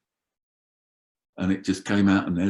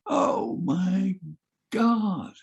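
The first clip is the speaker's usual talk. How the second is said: shocked gasp; 10 semitones higher, much slower than usual. Retold or imitated. Shocked reaction to blood.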